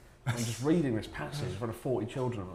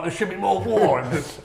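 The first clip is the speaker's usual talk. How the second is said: mock voice; 8 semitones higher, 6 dB louder than usual. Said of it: "I'm not like".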